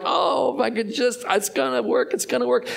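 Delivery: high, pleading mock hope; mock hopeful plea. Mocking our clinging hopes.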